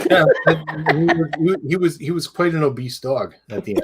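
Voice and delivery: laughing through the words; chuckling while talking. Real, not acted. Laughing about the obese dog.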